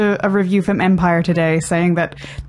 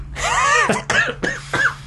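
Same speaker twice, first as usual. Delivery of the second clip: laugh; 3 dB quieter than usual. Real, not acted. Laughs after her own review.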